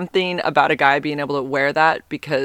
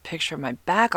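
whispered, breathy self-scolding; inner whisper; 7 dB quieter than usual. Retold or imitated. Kicking herself inside after sending the photo, whispering. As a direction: hiss it to yourself like a private regret. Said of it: "inside I'm just like".

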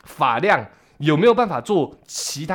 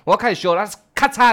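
ordinary talk

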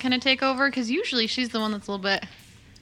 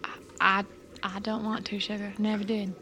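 soft, quiet and tender; reassuring the frightened child quietly; gentle reassurance; 2 semitones lower, 8 dB quieter than usual. Acted. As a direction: promise the kid you mean no harm.

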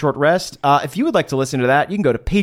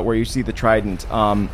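ordinary talk, nothing marked

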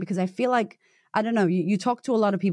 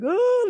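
a much higher, louder, hammed-up groan; mock despairing wail; 4 dB louder than usual. Coach mocking herself on the couch, a high hammed-up wail. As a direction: wail it like a sulking failure.

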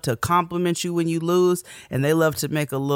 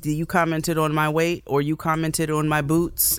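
ordinary talk

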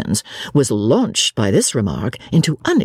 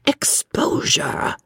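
drawn-out, whispery hiss; 3 semitones higher, 4 dB quieter, much slower than usual. A narrator's reading. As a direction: hiss it slowly under your breath. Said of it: "hissed Mister Eager".

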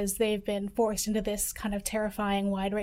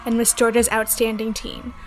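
news read; 6 dB louder than usual. Acted. Reporter reads scripted story.

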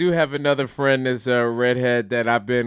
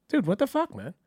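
Nathan impression; 10 semitones higher than usual. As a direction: imitate an indignant suburban dad, voice pitched way up. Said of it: "my accent?".

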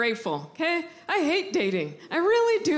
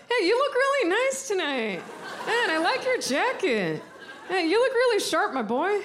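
beaming proud parent, higher; proud dad; 4 semitones higher than usual. Retold or imitated. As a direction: cheer him on like a proud father. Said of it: "I sound like a proud dad".

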